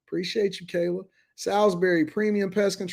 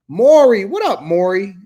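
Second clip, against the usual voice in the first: excited shout-out; 2 semitones higher, 9 dB louder than usual. Real, not acted. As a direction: holler the name in greeting. Greeting a chatter excitedly.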